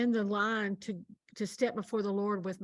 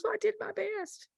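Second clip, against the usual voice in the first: resigned; 11 semitones higher, 4 dB quieter than usual. Retold or imitated. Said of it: "And she goes".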